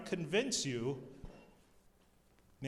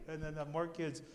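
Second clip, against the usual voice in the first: ordinary talk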